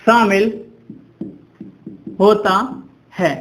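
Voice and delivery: each word set apart by pauses; dictation pace. Shown as a demonstration. Dictating definition for students to write. (शब्द-शब्द अलग करके इमला बोलते हुए)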